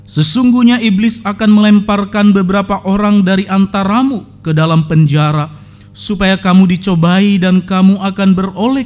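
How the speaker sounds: loud, measured Bible reading; solemn scripture reading. Acted. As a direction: proclaim the verses to the congregation. Reads scripture aloud. (pembacaan Alkitab yang khidmat dan lantang)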